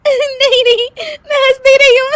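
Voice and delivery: high, loud giggling protest; squealed, then cackling; laughing denial. Acted. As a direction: insist through helpless laughter. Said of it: "हंस क्यों रही हो".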